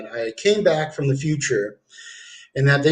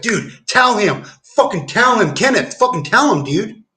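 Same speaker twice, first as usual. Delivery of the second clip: raised, pushy voice egging him on; urgent urging; 6 semitones higher than usual. Retold or imitated. Cardinal urging him outside.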